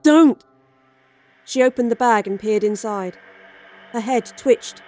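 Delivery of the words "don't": loud urgent cry; sharp shout. Acted. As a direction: yell it as a warning. Shouted warning.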